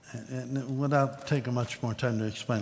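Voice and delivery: ordinary talk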